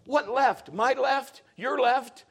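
high, rising volume, bewildered questions; confused young player. Retold or imitated. Son asking coach where to line up, bewildered. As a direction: play a kid totally lost on the field.

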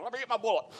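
eager deputy reaching for his bullet; Barney Fife bit. Retold or imitated. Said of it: "that's almost like, you know".

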